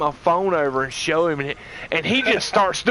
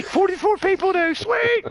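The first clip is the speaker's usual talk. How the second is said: mocking wife. Mock-voicing the wife.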